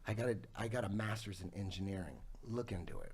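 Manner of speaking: low, soft, matter-of-fact quote; calm retold assurance. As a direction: drop low and quiet, calmly sure. Acts student calmly pulling rank.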